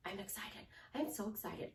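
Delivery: very soft, hushed start; excitement starting small. Shown as a demonstration. Coach showing how to talk yourself up, hushed. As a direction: begin it almost under your breath. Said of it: "you start doing this".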